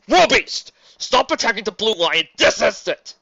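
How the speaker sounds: "Road beast" yelled very high and loud; raging snarl. Acted. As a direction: scream the name in rage. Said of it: "Lotor snarled in rage".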